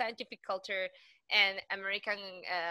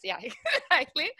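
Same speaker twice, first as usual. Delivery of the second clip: higher, louder laughing agreement; 8 semitones higher, 6 dB louder than usual. Real, not acted. Speaker laughs at her own love of astrocytes. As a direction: laugh as you agree, slowly.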